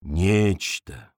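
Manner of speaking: low, slow savouring; tasting a new word. A narrator's reading. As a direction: roll the word "Нечто" slowly. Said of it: "как будто пробуя на вкус новое слово".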